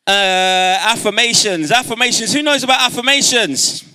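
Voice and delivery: high, loud crowd call; rallying call-out. Real, not acted. Speaker getting audience ready for affirmations, rallying shout. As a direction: call it out to the back of the hall.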